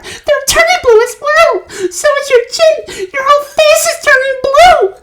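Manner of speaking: horrified mother screaming. A narrator's reading. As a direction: scream it in horror. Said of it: "screamed Missus Beauregard".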